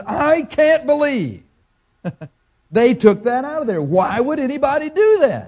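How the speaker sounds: mock the fellow's stunned outrage; imitating outraged disbelief. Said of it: "he was mad".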